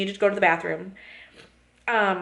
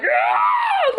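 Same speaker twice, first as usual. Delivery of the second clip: short high shrieked "Ah!", much higher and louder; retold toddler scream; 4 dB louder than usual. Daughter melting down in the bathroom, imitated shriek. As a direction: mimic a child's full-on scream. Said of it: "Like, she was screaming".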